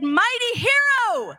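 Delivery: high, slow, ringing call; proclaimed greeting. Acted. Angel of the Lord hailing Gideon in a high, slow ringing call. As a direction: announce him grandly like a herald.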